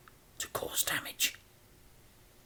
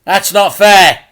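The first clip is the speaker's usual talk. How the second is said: put-on higher, louder boy protest; 10 semitones higher, 15 dB louder than usual. A narrator's reading. Frank protests again, loud. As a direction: insist it's unfair. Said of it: "said Frank".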